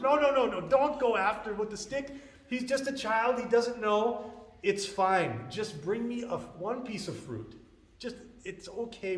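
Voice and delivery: high-pitched, urgent protest, easing off in volume; disturbed pleading. Retold or imitated. Brahmana stops father with the stick, high-pitched pleading. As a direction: plead hard to protect the child. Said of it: "disturbs the Brahmana. He says".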